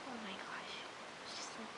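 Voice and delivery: barely voiced, shocked whisper; stunned whisper. Vlogger reliving shock at the slap, stunned whisper. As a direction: whisper it, dumbstruck. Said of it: "I was so in shock".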